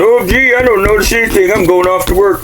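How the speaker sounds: high cheerful mock voice of a man leaving for work; oblivious husband. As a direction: play a clueless guy heading to work.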